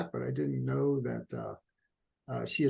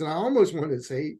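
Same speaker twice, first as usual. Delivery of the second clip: ordinary talk, nothing marked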